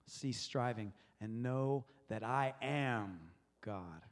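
"am" drawn out within a slow, low reading; measured words, lingering on "am" before God; slow, deep weight on am; stress on am. Shown as a demonstration. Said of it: "I'm gonna go to the next word".

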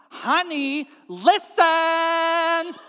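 loud, high, stretched-out "listen"; exasperated call. Acted. Wife demanding husband's attention, exasperated. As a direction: escalate into a drawn-out yell.

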